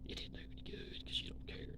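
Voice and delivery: breathy hushed aside; whisper. Real, not acted. Telling Square it did no good, whispered. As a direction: drop to a whisper.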